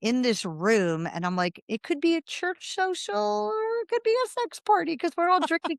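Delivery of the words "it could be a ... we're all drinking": much higher, tongue-in-cheek musing; wry observation about the lemonade party, pitched high; amused aside. Retold or imitated. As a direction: toss it off like a wry private joke.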